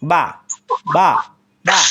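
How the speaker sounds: বা released from the wet part of pressed lips. Shown as a demonstration. Teacher modelling বা with pressed lips. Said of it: "ঠোঁটে ঠোঁট চাপার পরে আসবে বা".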